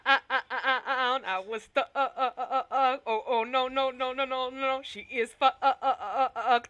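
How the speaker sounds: words broken by laughter; laughing helplessly. Real, not acted.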